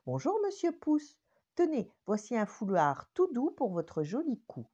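high, out-of-breath greeting; a shy little one arrives puffing; breathless little finger voice. Acted. The shy little finger greets Monsieur Pouce, high and breathless.

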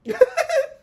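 short high giggle; a quick squeal of laughter. Real, not acted. After joking about the actor, a high giggle.

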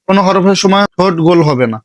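usual speaking voice

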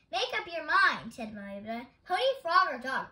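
exasperated, punching each choice; impatient demand. A narrator's reading. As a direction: lose patience with a muddled caller. Losing patience with confusing caller.

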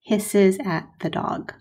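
slow, clear model sentence; example sentence read slowly. Shown as a demonstration. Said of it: "So for example, I might say".

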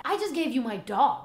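ordinary delivery

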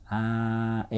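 held "aah" with a cramped mouth space; narrow Japanese-style vowel. Shown as a demonstration. Coach exaggerates how Japanese singers sound, shallow narrow "aah". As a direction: keep the "aah" shallow and squashed. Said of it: "大げさに言うと".

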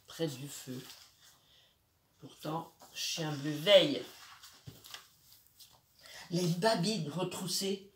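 usual speaking voice

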